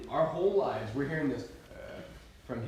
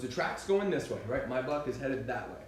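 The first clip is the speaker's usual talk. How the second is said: ordinary talk, nothing marked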